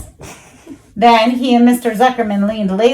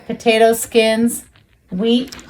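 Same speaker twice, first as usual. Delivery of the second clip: plain reading voice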